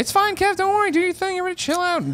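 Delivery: high calming voice. Acted. Calming crew during blackout.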